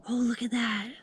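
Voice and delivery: hushed, thrilled gushing; excited. Real, not acted. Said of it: "I'm excited".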